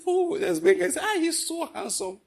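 a hint of high doting grandma; gushing grandmother. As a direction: coo the praise lightly.

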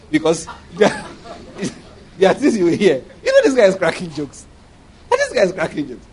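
talking through laughter in amused disbelief; amused, laughing. Real, not acted. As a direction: chuckle through the words. Amused at pastors' doctrines.

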